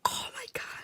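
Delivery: hushed, breath-only awe; overwhelmed whisper. Real, not acted. Fan admiring cat ears on Yurio, overwhelmed whisper. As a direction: whisper it, quite overcome.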